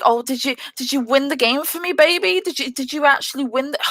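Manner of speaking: a hint of a smug teammate; condescending. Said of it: "made me so angry".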